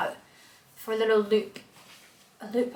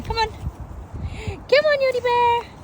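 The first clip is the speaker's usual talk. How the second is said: much higher sing-song pet call; calling the dog; 12 semitones higher, much slower than usual. Real, not acted. Calling Yodi away from the mud, high baby talk.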